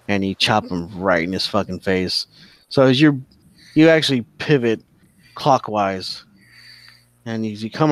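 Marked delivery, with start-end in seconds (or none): none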